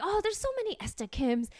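lightly mocking old Korean church lady; older Korean lady. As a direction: wave it away like a dismissive church auntie. Old Korean lady brushing her off.

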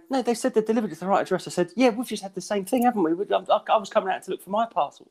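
high, hurried mock protest; flustered retelling. Acted awkward doorstep exchange with neighbour.

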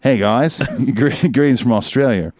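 put-on a light, half-hearted Australian accent; a touch of Australian accent, reading a fan email aloud. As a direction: read it with a hint of Aussie twang. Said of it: "Are you doing it in the accent or not?".

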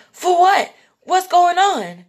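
high, loud panicked demand; acted alarm. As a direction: blurt it out in mock panic. Acts out alarmed reaction.

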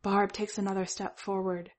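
ordinary delivery, nothing marked

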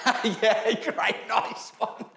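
slow laughing "great, nice one"; mock sarcastic, laughing. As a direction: drawl it with a chuckle. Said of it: "he goes".

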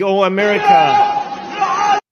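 slow, stretched imitation of a man calling out; drawn-out call. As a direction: drag the plea out like a rooftop cry. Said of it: "shouting in Gaza".

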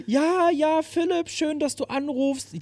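hohe, erfreute Sängerinnenstimme; begrüß ihn herzlich und überschwänglich; erfreute Sängerin. Retold or imitated. (Sängerin freut sich hoch und herzlich über den Anruf)

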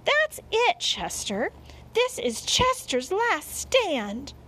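high, emphatic scolding; exasperated scolding. A narrator's reading. Mother fed up with the cat, exasperated scolding. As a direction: lay down the law, voice leaping up.

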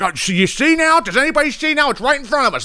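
high-pitched, worked-up imitation; mock alarmed politician. Congressperson talking at some hearing, high mock alarm. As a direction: mimic a panicky speech at a hearing.

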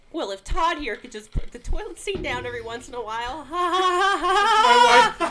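shrill fake laugh, nagging; mocking nagging wife. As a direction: nag then cackle at him. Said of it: "and they're like".

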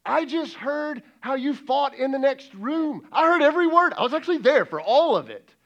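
high, shouted indignation; exasperated God. Acted. God who overheard the fight next room, indignant shout. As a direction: build to a loud, indignant shout. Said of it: "but God has to be going".

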